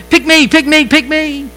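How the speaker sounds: high-pitched, pleading schoolkid imitation; mock eager child, rapid repeats; eager kid volunteering. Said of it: "I was one of those kids".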